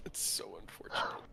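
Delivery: breathy whispered repeat; whispered mock lament. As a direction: hush it like a sad secret.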